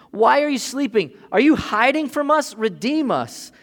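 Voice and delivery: loud plea. Retold or imitated. Psalmist pleads with God.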